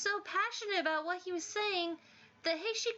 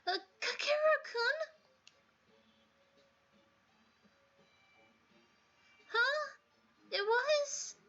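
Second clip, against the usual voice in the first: much higher, gentle worried voice; timid sweet student; 6 semitones higher than usual. Acted. Timid student worrying to Kakeru about Senri, high and gentle. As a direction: plead kindly for him.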